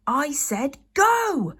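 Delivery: slow, loud, exasperated repeat; frustrated. Acted. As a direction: drag out the order in frustration. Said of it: "Hiccup repeated in frustration".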